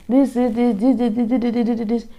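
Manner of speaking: rapid-fire repeated "this"; imitated lecturer explaining. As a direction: rattle off "this" in a softened, even stream. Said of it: "The lecturer is explaining something".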